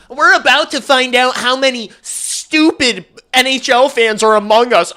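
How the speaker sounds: high, loud, smug nerd voice; mocking Twitter know-it-all. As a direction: sneer it like a smug armchair expert. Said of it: "I hate people who talk like this".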